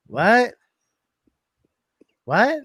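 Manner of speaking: disbelieving "what", rising sharply; baffled query with a steep upward lift; incredulous. Retold or imitated. Husband taken aback by wife's request, incredulous.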